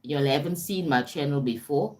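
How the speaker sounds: normal talking voice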